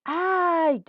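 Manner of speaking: high, loud, drawn-out mock yelp; pained cry. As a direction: cry out as if just bitten. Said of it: "Y Pedrito gritó". (grito de dolor actuado)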